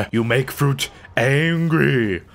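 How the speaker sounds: slow, low mock growl; mock menacing voice. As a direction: play the big angry brute, slowly. Mock-threat voice after sigh.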